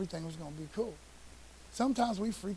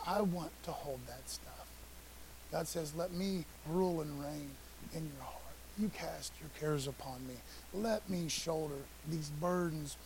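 gentle voice of God; 3 semitones lower, 8 dB quieter than usual. Acted. God voiced inviting burdens.

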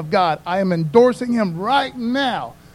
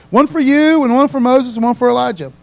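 loud, eager offer, pitched up; eager Peter; 4 semitones higher, 9 dB louder than usual. Acted. Peter to Jesus on the mountain, eagerly loud. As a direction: blurt out the plan, thrilled.

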